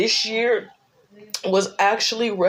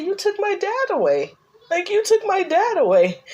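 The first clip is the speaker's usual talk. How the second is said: hurt cry; 10 semitones higher than usual. Retold or imitated. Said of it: "I said".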